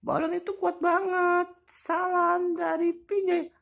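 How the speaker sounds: very high squeaky toy, worn out. Pretend. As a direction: squeak like a defeated little toy. Toy voice, defeated.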